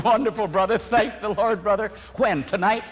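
high, loud delighted praise; overjoyed, ringing thanks to a brother; joyful praise. Retold or imitated. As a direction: burst out happy for the new convert. Joyful response to conversion.

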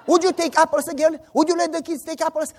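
loud, high scolding questions; mock angry interrogation. Father pretending to beat the children, shouting questions. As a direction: hammer out each question like a raging father.

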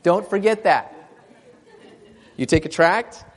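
high, loud preacher's call; fervent preaching. Acted. Preacher urging the congregation at full force. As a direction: preach it out to the folks.

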